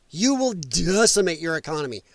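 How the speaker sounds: normal talking voice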